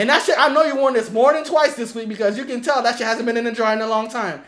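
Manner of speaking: roasting through laughter; laughing roast. Real, not acted. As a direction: laugh while you tease.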